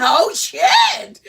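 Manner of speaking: very high, loud startled shout; startled oh shit. Real, not acted. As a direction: cry out in surprise as the dog bursts in. The dog bursts in.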